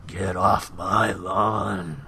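very slow, gravelly growl; gravelly, menacing slow drawl; Clint Eastwood. Acted. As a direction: drag it out in a gravel-voiced tough old man's warning. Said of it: "you had a Clint Eastwood in you".

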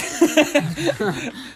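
sudden high laugh; high burst of laughter. Real, not acted. Laughs at mom joke.

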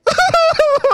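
high hammed-up wailing cry; loud fake sob of defeated fans; cartoonish crying howl; mock wail. Rivals crying after comeback.

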